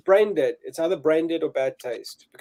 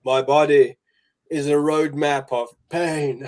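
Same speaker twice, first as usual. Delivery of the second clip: dramatic movie quote; 5 dB louder, slower than usual. Retold or imitated. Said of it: "He goes".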